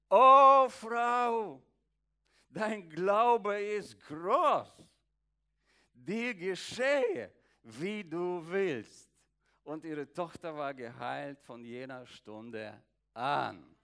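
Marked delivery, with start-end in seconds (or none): 0.1-9.1 slow, loud, high proclamation; solemn Jesus. Acted. Jesus answering the kneeling woman, a slow, loud, solemn proclamation. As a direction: proclaim it with great weight, slowly.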